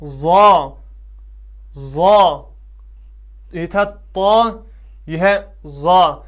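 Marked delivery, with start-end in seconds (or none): 0.0-2.4 ظا loud, lower and rounded, toward "zhaw" with tongue forward; full-mouthed, low ظا; thick letter sound. Shown as a demonstration. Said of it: "اور اسی طرح". 3.8-6.1 طا and ظا both full and rounded; emphatic طا and ظا, toward "aw"; matching thick letters. Shown as a demonstration. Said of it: "جیسے یہ طا پڑھا ویسے ہی".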